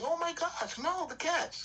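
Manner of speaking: high-pitched cat lady fretting; mock-horrified old cat lady. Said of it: "how many old cat ladies do you think".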